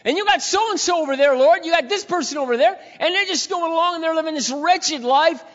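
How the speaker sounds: high, loud complaint thrown up to the Lord; preacher voicing the complaint to the Lord, high and loud; full-force preaching. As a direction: preach it like an accusation to heaven.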